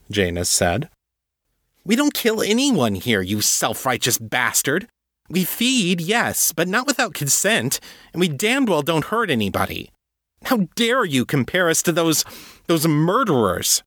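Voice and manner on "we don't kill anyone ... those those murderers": much higher, heated and indignant; she's lost her temper and is shouting him down; furious outburst. Acted. Sylvia furious at the agent's accusation, high and heated.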